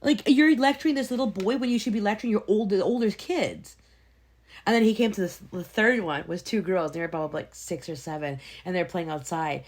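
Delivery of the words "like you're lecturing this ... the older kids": indignant rant. Retold or imitated. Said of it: "And I'm like".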